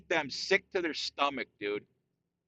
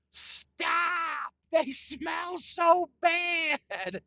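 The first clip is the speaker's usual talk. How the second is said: much higher mock shriek, slow and drawn out; imitating wife shrieking; 12 semitones higher, 3 dB louder, much slower than usual. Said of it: "my wife goes".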